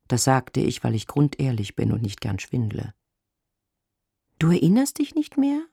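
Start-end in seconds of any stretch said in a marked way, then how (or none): 4.4-5.7 very high, disbelieving question; high-pitched astonished woman; incredulous woman caller. A narrator's reading. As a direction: ask it, taken aback, in a high voice.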